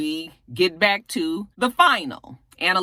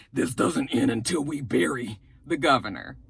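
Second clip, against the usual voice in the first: grim Nate; 7 semitones lower, 4 dB quieter than usual. Retold or imitated. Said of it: "He says".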